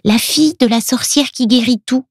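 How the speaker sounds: put-on high-pitched little-girl voice; Luna. A narrator's reading. Little girl introduces herself to Milo, high child voice. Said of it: "répond-elle".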